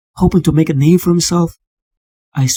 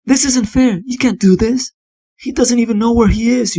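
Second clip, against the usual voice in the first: outraged protest; 6 semitones higher than usual. Acted. Protesting at the locked door.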